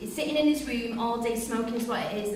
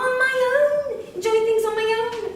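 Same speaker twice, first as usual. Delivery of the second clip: defiant assertion; 8 semitones higher, 5 dB louder, slower than usual. Acted. Wife asserting independence.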